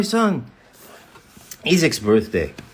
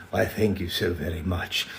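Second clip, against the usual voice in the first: low soft gracious voice; 5 semitones lower, 10 dB quieter than usual. Pretend. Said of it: "responds to nice beard".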